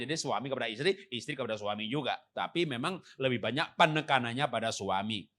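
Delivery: ordinary delivery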